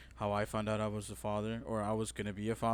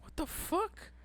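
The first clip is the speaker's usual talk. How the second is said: stunned disbelief; 4 dB quieter, slower than usual. Retold or imitated. Reacting to hearing she's a month pregnant, mock-stunned.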